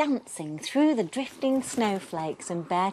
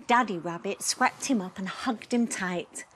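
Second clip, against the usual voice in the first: ordinary delivery, nothing marked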